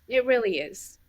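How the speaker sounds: ordinary talk, nothing marked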